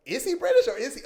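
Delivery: high, loud puzzled outcry; mock bewildered question; confused onlooker. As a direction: imitate someone thrown by an accent.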